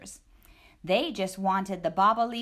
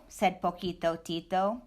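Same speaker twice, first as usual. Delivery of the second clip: plain reading voice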